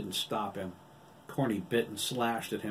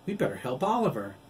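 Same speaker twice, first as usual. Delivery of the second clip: urgent call; 5 semitones higher, 5 dB louder, faster than usual. A narrator's reading. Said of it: "Edison exclaimed".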